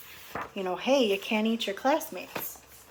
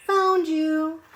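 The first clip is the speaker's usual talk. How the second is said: playful found you; 7 semitones higher, 7 dB louder than usual. Acted. Kids playing hide and seek.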